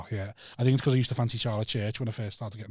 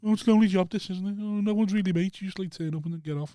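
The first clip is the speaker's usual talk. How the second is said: high-pitched mocking whinge; teasing imitation of a lonely moaner, pitched up; mock sob story; 7 semitones higher than usual. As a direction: whine it like a hammed-up tale of woe. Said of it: "I had the violin out".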